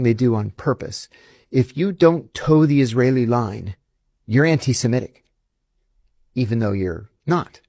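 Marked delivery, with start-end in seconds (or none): none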